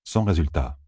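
usual speaking voice

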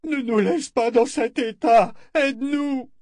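very high, strained begging; cling to him with the words; desperate plea. Acted. The men imploring Zed not to leave them, high and desperate. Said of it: "Un concert de suppliques".

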